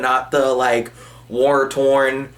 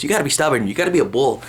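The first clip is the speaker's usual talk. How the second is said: ordinary talk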